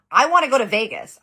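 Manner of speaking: imitated yell. Old man slamming the table, yelling about Vegas. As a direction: bellow it like a furious old man. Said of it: "He's yelling about Vegas".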